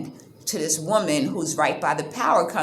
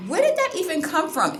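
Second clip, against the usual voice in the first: baffled question; 10 semitones higher than usual. Retold or imitated. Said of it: "And I was like".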